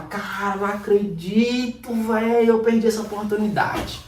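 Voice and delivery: sighing over a missed trade, understated; mild regret. Retold or imitated. The trader mildly regretting a missed entry. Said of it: "Aí você fala".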